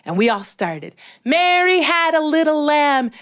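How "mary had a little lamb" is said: higher, even chant; sing-song group recital; kids reciting. Retold or imitated. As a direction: recite it like a class in unison. Kids reciting all together.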